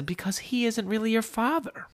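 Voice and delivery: high-pitched mock mom voice; smiling mother. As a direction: deliver the punchline in a high, smiling voice. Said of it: "his mom just smiles and says".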